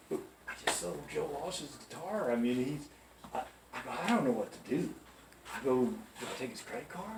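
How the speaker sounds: soft, halting plea for help; hushed confiding. Retold or imitated. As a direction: reenact a rookie quietly confessing to the boss. Rookie quietly asks manager for help.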